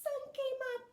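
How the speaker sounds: very high, loud squirrel chirp; high squeaky squirrel voice. Acted. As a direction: sing it out like an excited little squirrel. Squirrel repeats his chirp.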